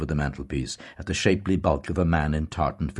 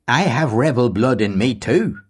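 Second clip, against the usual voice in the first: put-on stern old Tory lecturing; 7 semitones higher, 7 dB louder than usual. A narrator's reading. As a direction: hammer home the history to the young man. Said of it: "Mister Deasy said".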